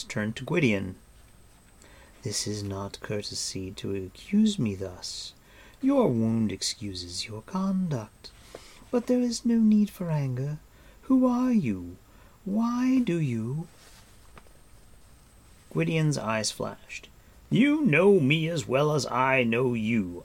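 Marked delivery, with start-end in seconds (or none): 2.2-13.7 stately rebuke, pitch lifting as it goes; cool imperious woman. Acted. Woman chiding her wounded captive, cool and imperious. 17.5-20.2 angry defiance. Acted. Captive defies his captor.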